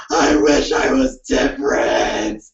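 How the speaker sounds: hammed-up sob; mock crying. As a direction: gasp then low, slow weepy whine. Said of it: "up all night like".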